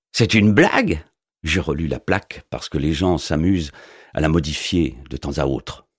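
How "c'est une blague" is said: a higher, louder jeering mailman voice; lightly voiced sniggering postman; mocking mailman. A narrator's reading. Postman jeering at the wizard's door sign, mocking.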